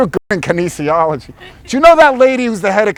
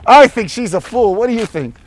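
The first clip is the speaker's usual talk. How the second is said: 1 mock indignant; 6 semitones higher, 4 dB louder than usual. Real, not acted. Ranting to a stranger.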